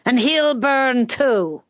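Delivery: slow, high, threatening call; harsh woman calling. A narrator's reading. Woman in the crowd threatening the culprit, harsh call. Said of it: "called a harsh woman's voice".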